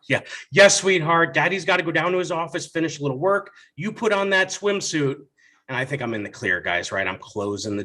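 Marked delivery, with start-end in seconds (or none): 0.5-5.2 relieved hurry. Retold or imitated. Relieved dad, quick.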